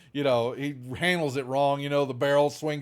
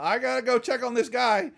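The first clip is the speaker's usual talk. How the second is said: nervous orderly; 10 semitones higher than usual. Acted. Orderly voiced by GM.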